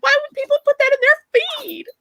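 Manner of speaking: very high, incredulous squeal; high-pitched appalled complaint. Real, not acted. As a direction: squeak it out in disbelief. Appalled at snakes in feed.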